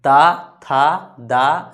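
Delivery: slow, loud, stretched dental ता था दा; demo syllables. Shown as a demonstration. Teacher demonstrates dental sounds to class, slow and loud. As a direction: draw out each dental syllable. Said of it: "बोलिए".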